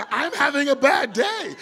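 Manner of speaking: high, slow, loud and upbeat; cheerful shout. Pretend. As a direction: declare it brightly anyway. Happy person saying bad day.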